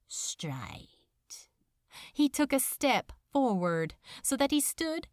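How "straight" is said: menacing whisper. A narrator's reading. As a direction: drop to a rasping whisper on the last word. Demon lord's veiled threat.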